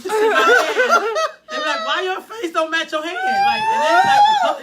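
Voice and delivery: laughing loudly through the words; high, loud laughing delivery. Real, not acted. Laughing while telling the story.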